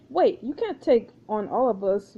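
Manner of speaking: normal talking voice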